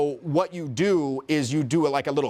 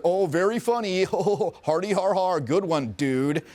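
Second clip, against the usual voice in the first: higher mock laugh, then dry; imitated sarcasm; 4 semitones higher, slower than usual. Girl answering his clapback, imitated sarcasm. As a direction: mimic her unimpressed fake amusement.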